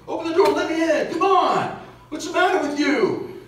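high, loud yell with a laugh behind it; retold yelling. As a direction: shout at her to open up. Man yelling at wife again.

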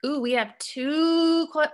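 ordinary talk, nothing marked

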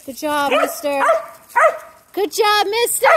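high, loud praise voice; dog praise. Real, not acted. Trainer praising the gentle male dog, high and loud. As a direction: reward him with your voice.

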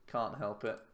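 normal talking voice

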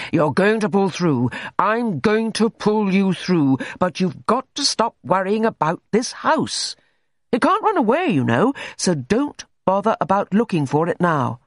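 bullying doctor, emphatic and loud; boisterous, blunt doctor. A narrator's reading. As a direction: drive it home to the patient. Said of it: "with a boisterous bluntness of manner".

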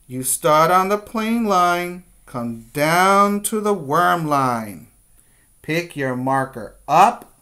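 guiding a class through letter shapes, firmly; step-by-step teacher voice. Shown as a demonstration. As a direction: call each stroke out to young kids. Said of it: "teacher models letter strokes".

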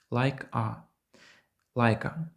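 "Лайка" said as one word with no break; linked like a. Shown as a demonstration. Teacher showing how like a links. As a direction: blend the k straight into the a. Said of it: "Чуєте, як швидко виходить".

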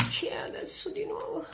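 whispered, exasperated; annoyed whisper. Acted. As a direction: hiss it under your breath at the phone. Phone rings yet again. (sussurrato e spazientito)

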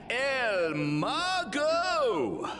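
hyped luchador introduction; ring announcer call. Acted. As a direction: stretch the name like an announcer.

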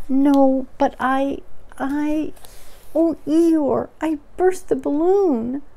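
high, halting confession; distressed Piglet. Acted. Piglet admits to Eeyore he burst the balloon, distressed. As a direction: blurt it out in a small high voice.

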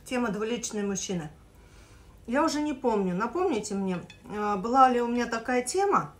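chewing between words; eating while talking; mouth full of pasta. Real, not acted. Seen 3: takes a forkful at 1.3s, mouth works food while speaking.

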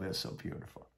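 a hushed, reverent whisper; whispered wonder at the miracle; awed whisper. Real, not acted. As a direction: breathe it out in awe, almost silent.

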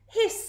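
short high goose hiss. A narrator's reading. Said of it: "said the goose".

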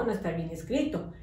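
ordinary delivery

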